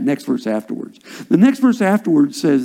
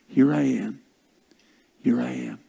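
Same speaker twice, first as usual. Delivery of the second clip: hushed, low, repeated offering; soft surrender; 4 semitones lower, 7 dB quieter than usual. Acted. Believer answering God in prayer, soft surrender. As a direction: say it softly, sinking quieter the second time.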